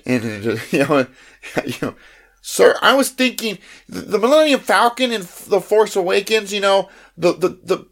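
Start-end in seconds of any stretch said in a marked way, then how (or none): 2.4-7.9 higher, eager nerd asking a fussy question; nerdy fan voice. Retold or imitated. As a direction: play the pedantic Star Wars fan, pitched up. Nerdy fan asking Disney president.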